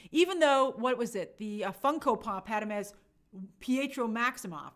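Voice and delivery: usual speaking voice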